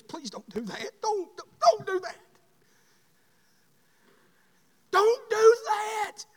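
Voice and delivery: a hint of a parent begging their child; parent's pleading whisper at the end. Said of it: "mom and daddies ... telling their kids".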